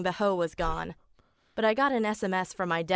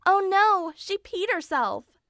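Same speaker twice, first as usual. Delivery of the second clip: high, louder narrated cry of alarm; storybook scream, pitched way up; 10 semitones higher, 4 dB louder, slower than usual. A narrator's reading.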